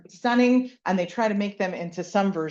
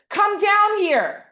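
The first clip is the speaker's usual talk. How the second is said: higher, louder and slow; mad yell; 11 semitones higher, 7 dB louder, slower than usual. Retold or imitated. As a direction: yell for the kid to come down. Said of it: "I never say".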